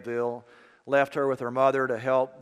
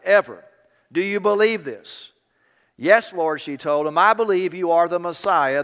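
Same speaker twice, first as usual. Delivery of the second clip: raised, louder preaching voice with growing fervour; preaching sermon; 4 semitones higher, 6 dB louder than usual. Acted. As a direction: preach it out to the congregation, building intensity. Preacher building to full force.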